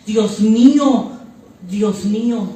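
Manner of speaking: a drawn-out, heartfelt invocation; emoción fuerte. Retold or imitated. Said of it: "un sentimiento tan fuerte". (con fuerte emoción)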